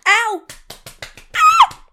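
very loud, very high yelp; pained shriek. Acted. Crying out loudly as the blow lands. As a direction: scream out at the smack.

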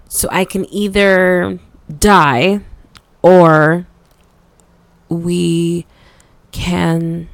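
loud, weighted words with long gaps; heavy, halting emphasis; deliberate spelling-out. Acted. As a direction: lay out the options one word at a time. Spelling out two stark options.

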